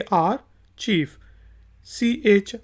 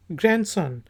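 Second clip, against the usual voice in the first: ordinary talk, nothing marked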